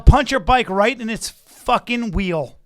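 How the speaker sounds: mock threat. Cohost playing out threats to the cyclist, slow and loud. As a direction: spell out the threat word by word.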